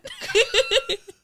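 squealing high laugh. Real, not acted. Laughing after crow voice.

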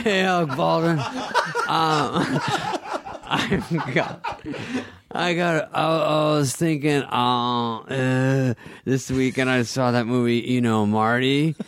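can't hold the nervous Farley voice for laughing; laughing Farley impression. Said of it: "He calls him both names like a psycho".

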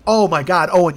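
normal talking voice

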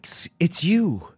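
put-on a rough stab at [name]'s accent, voice lifted; failed [name] accent. [name] leans in and recognizes the interviewer, rough attempted accent. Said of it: "I can't do the accent".

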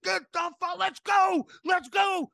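rallying the team after the foul, hammed-up high shout; retold fired-up shout. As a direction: yell it like a pumped-up coach rallying the team. Miller fired up, yelling to team.

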